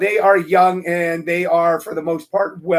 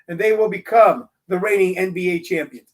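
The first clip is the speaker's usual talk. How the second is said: usual speaking voice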